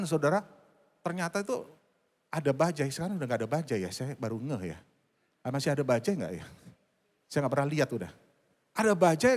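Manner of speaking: ordinary talk, nothing marked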